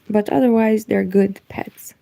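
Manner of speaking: ordinary talk, nothing marked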